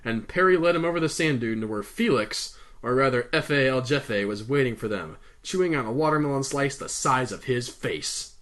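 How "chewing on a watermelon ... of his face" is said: plain reading voice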